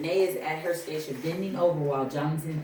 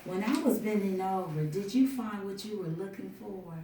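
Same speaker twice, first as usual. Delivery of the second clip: higher, teasing flirtation; seductive; 4 semitones higher than usual. Acted. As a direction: tease him suggestively. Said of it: "leans in close seductively".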